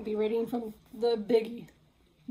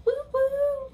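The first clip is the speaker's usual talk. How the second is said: much higher, louder whoop; cheering woo; 6 dB louder than usual. Acted. Piggy celebrating her throw, cheering. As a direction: whoop like a winner.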